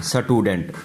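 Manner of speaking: स्टूडेंट repeated as "sa-tudent"; wrong added vowel. Shown as a demonstration. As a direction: put a short "a" between s and t in स्टूडेंट. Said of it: "नहीं बोलना".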